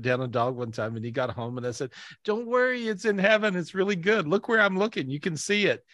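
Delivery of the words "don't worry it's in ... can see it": higher-pitched, upbeat coaxing; retold consoling in a lifted, eager voice; mock cheerful reassurance. Telling Dean his dog is in heaven, mock cheerful reassurance.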